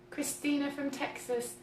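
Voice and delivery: ordinary delivery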